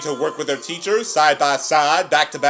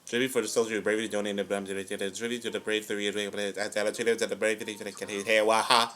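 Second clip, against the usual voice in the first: rattled-off babble; 3 semitones lower, 6 dB quieter than usual. Pretend.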